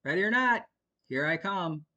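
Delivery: put-on father warns hiding kids, playfully; playful threat. A narrator's reading. A dad calls out to hiding kids. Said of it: "Alden threatened".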